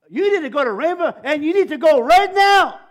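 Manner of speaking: high, shouted order from the Lord; booming divine command. Retold or imitated. Third voice interrupts a praying man, booming command. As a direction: build to a full-force, commanding shout. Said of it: "Just like that".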